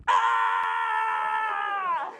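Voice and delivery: long high scream; startled mock scream. Woman sees comic walking into women's room, mock scream. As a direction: scream as if shocked at the sight. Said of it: "we're just screaming".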